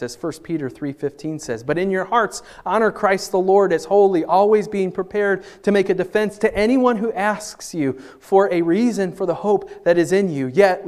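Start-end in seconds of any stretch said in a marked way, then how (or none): 1.6-10.9 high, loud, ringing scripture; forceful scripture reading. Acted. Pastor reading First Peter aloud, loud and high. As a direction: proclaim the verse to the room.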